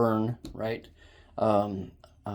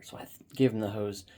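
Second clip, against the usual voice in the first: ordinary talk, nothing marked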